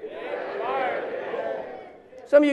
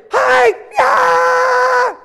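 much higher, loud fan yell; stadium cheer; 13 semitones higher, 4 dB louder than usual. Shown as a demonstration. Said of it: "They can say".

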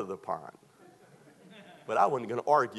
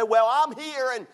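high, loud, resigned whine; imitating a resigned missionary, high and loud; 11 semitones higher, 6 dB louder than usual. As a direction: sound stuck and put-upon. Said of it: "and he said".

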